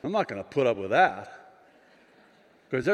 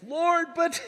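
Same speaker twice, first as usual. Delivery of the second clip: high, raised-voice lament; lamenting cry. Retold or imitated. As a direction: cry it out to heaven in protest. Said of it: "So as I'm lamenting".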